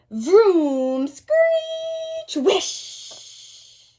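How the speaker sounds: vroom, screech and whish made with the mouth; bus noises. A narrator's reading. Teacher voicing bus noises for the class. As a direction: sound out the bus rushing by.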